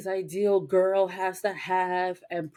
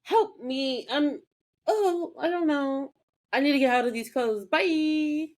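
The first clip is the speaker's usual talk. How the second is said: higher, rushed, mock flustered; flustered Piper; 8 semitones higher than usual. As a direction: bolt out in a hurry. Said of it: "she was like".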